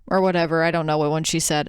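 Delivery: ordinary talk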